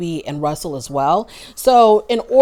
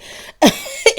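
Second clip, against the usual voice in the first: exasperated laugh; 4 dB louder than usual. Real, not acted. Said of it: "so infuriating".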